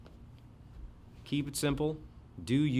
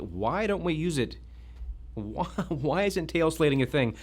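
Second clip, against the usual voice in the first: ordinary talk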